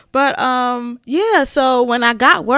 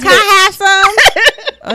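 whiny begging; 9 semitones higher, 10 dB louder than usual. Acted. Mock begging, voice high and loud.

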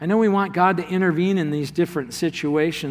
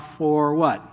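ordinary delivery

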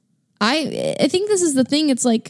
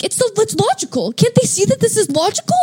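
higher, incredulous imitation; mock exasperated logician; 11 semitones higher than usual. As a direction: scoff at illogical people. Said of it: "you'll be like".

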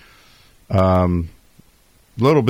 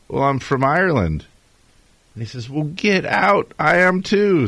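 put-on higher-pitched, half-hearted Irish accent; hint of Irish accent; 8 semitones higher than usual. Host telling an Irish bar joke, tentative Irish accent. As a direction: nibble at an Irish accent, then pull back. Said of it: "I heard you tried, almost started".

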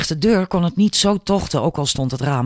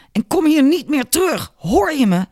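high-pitched, furious yelled warning; angry shout; 11 semitones higher than usual. A narrator's reading. Father banishing the aunt from his door, shouting angrily. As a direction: yell it as a final threat. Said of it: "schreeuwde hij tegen de vrouw".

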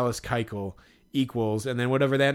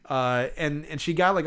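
usual speaking voice

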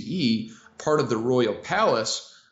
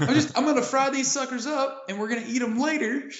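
very high comic pleading voice; mock innocent excuse; 12 semitones higher, faster than usual. Accused diviner explaining he is frying dinner, mock innocent and high. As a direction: make a hammed-up excuse, high-pitched. Acting pagan denying fortune-telling.

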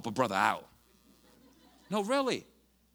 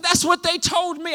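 defiant shout; 11 dB louder than usual. Retold or imitated. Retold defiant reply.

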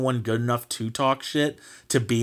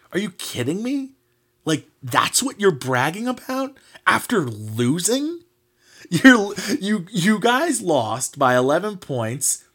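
incredulous; 6 semitones higher than usual. Real, not acted. Exasperated rant at rival's bragging.

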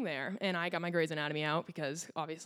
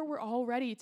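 usual speaking voice

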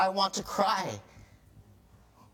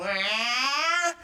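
high rising mock bawl; imitated child's cry; 8 semitones higher than usual. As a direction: join in like another crying toddler. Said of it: "this kid starts crying".

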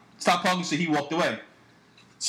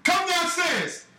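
high, slow imitated command; retold angry call-out; 8 semitones higher, slower than usual. Dad calling the man downstairs, mock angry. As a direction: call him down for a fight, drawn out.

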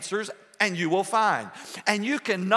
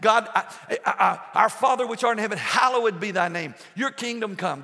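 impassioned prayer shout; 2 semitones higher than usual. Acted. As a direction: call out to God with full force. Crying out in prayer.

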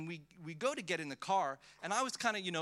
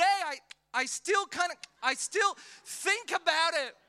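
ordinary talk

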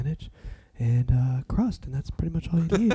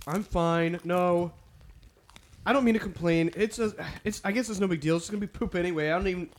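high-pitched, put-upon protest; softer, higher, hammed-up martyr act; mock wounded; 6 semitones higher, 5 dB quieter than usual. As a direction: play hurt while insisting it doesn't matter. Playing hurt over bland pizza.

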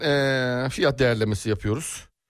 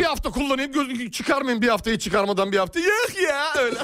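high, mocking squeaky parody voice; mock mimicry; 10 semitones higher than usual. Clerk mimics the whining customer, high mocking voice. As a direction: mimic him in a silly high voice.

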